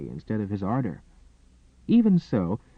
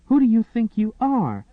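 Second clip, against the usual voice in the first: high, loud furious challenge; outraged demand, much higher and louder; indignant demand; 12 semitones higher, 6 dB louder than usual. Acted. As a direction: throw the question back at him in outrage. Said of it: "angry and embarrassed".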